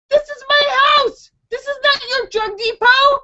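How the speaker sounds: very high, outraged protest; retold indignation. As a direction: tell off an intruder in a high voice. Retelling confronting the intruder.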